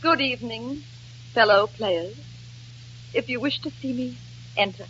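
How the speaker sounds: soft, measured stately greeting; grand wounded dignity. Acted. Actress receiving fellow players after sulking, grand dignity. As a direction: announce yourself with icy formality, pausing between phrases.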